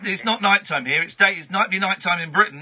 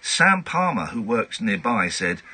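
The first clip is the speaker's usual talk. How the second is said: measured newsreader delivery, lightly mocking; news read; 5 semitones lower than usual. Host reading a tabloid story on air in newsreader style. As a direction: read the odd story like a bulletin.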